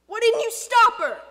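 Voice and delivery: very loud, high-pitched reproach; accusing outburst. Acted. Father blaming boy for daughter's going, accusing. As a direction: burst out with the blame.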